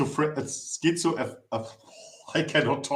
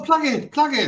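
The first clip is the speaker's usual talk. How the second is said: excited; 11 semitones higher, 3 dB louder than usual. Real, not acted. Urging cohost to plug channel.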